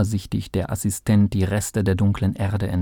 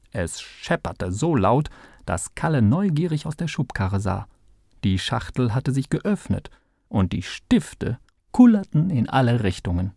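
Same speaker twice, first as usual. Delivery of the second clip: usual speaking voice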